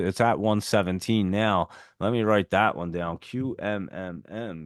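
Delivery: ordinary talk, nothing marked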